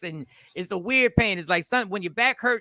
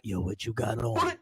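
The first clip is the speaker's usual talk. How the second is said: low, soft sleazy voice; creepy caller; 10 semitones lower, 9 dB quieter than usual. Retold or imitated. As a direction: purr it like a creep. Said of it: "be like".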